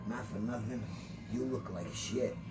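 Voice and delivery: softly telling the actor a private joke; confiding aside. Retold or imitated. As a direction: lean in and murmur it to him. Pulled him close to confide.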